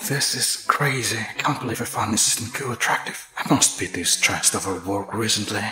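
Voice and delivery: hushed, sinking self-doubt; private musing. Acted. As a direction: quietly reason away a troubling thought. Boss reasons with himself, baffled.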